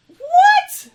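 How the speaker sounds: a shrieked, very high, loud what through a laugh; incredulous what. Real, not acted. Reacting to the forum calling her question a rant, shrieking in disbelief.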